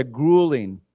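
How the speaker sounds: "grueling" said clearly for a class to repeat; model pronunciation. Shown as a demonstration. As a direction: model the word "grueling", falling at the end. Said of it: "why don't we say together".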